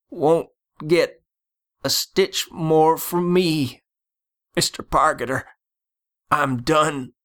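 high-pitched dying man refusing his tormentor; defeated dentist. A narrator's reading. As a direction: give up in a high voice, in broken phrases.

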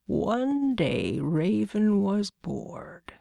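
very slow, drawn-out storytelling god; slow, portentous opening of a tale; Raven. Acted. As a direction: draw the words out in long swoops.

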